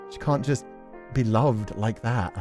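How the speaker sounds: ordinary talk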